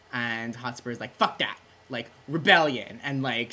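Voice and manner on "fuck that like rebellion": defiant shout. Retold or imitated. Hotspur defiant retold quote.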